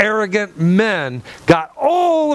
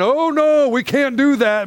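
higher mock protest; whiny Israelites; 8 semitones higher than usual. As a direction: whine like scared people backing out. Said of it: "the rest of you went".

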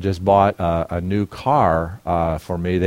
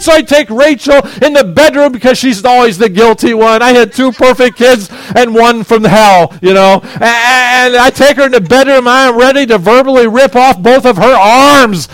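loud, high preaching at full force; heated shout; 13 dB louder than usual. Retold or imitated. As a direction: boom it out to the congregation. Preacher shouts angry retelling.

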